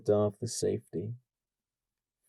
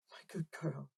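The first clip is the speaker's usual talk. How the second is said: tender whispered praise; 7 semitones higher, 13 dB quieter than usual. Acted. Mother whispering to her daughter, tender. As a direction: breathe it softly to her.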